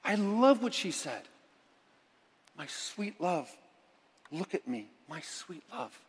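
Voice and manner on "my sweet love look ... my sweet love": soft, hushed endearment; gentle plea, fading softer; tender prayer. Acted. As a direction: murmur it lovingly to God.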